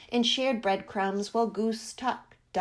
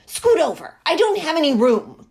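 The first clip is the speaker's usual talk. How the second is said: complaining; 4 semitones higher, 9 dB louder, faster than usual. A narrator's reading. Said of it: "complained Duck".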